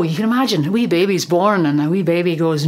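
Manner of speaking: ordinary talk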